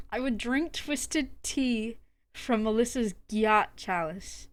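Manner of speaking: slow, suppressing a laugh; holding back laughter. Shown as a demonstration. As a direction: read it slowly, trying not to laugh. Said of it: "can't even say that with the straight face".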